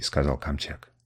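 usual speaking voice

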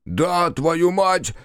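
high, drawn-out squeal; high-pitched, stretched curse; panicked shriek. A narrator's reading. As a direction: shriek it in exasperation. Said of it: "взвизгнул Макар".

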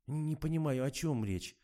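trembling voice. A narrator's reading. Kira denies all to the investigator, trembling voice. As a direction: let a mild tremble into it. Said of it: "дрожащим голосом заявила Фомичёва".

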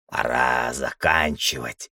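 low, slow grumble; old man's grumble. A narrator's reading. Old man alone by the Demiurge's core, grumbling. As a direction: grumble it to yourself, dragging the words. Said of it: "проворчал старик".

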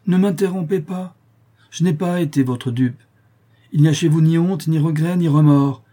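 loud, indignant accusation; indignant rebuke. Acted. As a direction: denounce him with mounting force. Father cuts son off, accusing.

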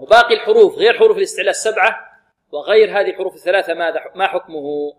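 ordinary talk, nothing marked

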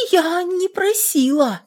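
high, slow, frightened; timid. Acted. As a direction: shrink back as you deny it. Frightened sister denies. (робко)

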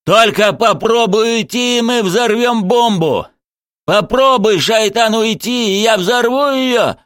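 high, loud threatening yell; desperate shout. A narrator's reading. Murtuz threatens to blow up the bomb, shouting. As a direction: shout the threat at full pitch. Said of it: "Муртуз крикнул".

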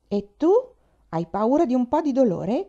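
put-on taunting a timid boy, with light scorn; scornful. A narrator's reading. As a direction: sneer at his fear of pain. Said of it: "Chiese Faith, sprezzante".

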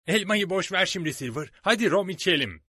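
loud, high-pitched, rowdy sailor; boisterous pirate interjection. Acted. Hands stops Dick and calls for rum, loud and rowdy. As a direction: cut in loudly, rallying mates to drink.

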